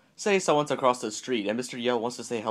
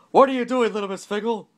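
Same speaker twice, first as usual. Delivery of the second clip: high, loud start easing off on the name; yelled question; 10 semitones higher, 5 dB louder than usual. A narrator's reading. Mister Yell spotting a shopper in the aisle, yelling. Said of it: "he yelled".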